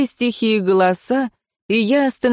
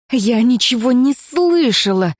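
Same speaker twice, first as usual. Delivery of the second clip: hissed protest; 5 semitones higher than usual. Acted. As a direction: force it through a gripped throat. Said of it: "зашипела я".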